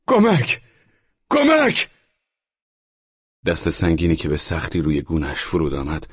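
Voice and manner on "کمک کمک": very high, loud cry of کمک; shrieking cry for help, rising higher; screaming for help. Acted. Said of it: "ترکوفسکی فریاد زد".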